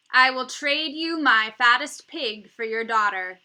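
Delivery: loud offer. A narrator's reading. Igor bargains for daughter.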